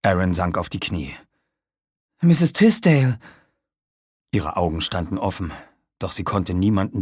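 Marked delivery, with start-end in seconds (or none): 2.2-3.2 high, loud shocked cry; horrified cry. Acted. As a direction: cry out her name in horror. Aaron kneels by dying woman. (entsetzter Ausruf)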